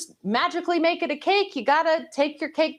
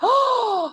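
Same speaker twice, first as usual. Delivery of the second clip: a quick shocked intake of breath, pitched up; startled gasp; sharp gasp; 8 semitones higher than usual. Real, not acted. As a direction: gasp as if the price is outrageous. Shocked at the cake price.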